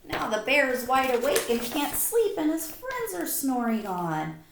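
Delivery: ordinary talk, nothing marked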